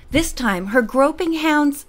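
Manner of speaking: ordinary delivery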